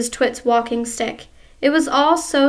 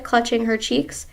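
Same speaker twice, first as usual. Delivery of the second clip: ordinary delivery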